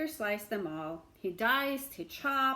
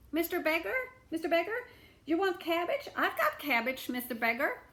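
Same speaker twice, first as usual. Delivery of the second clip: woman calling out; 8 semitones higher, 3 dB louder than usual. A narrator's reading.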